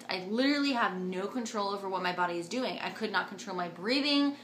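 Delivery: usual speaking voice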